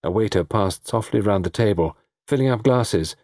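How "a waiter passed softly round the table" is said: ordinary delivery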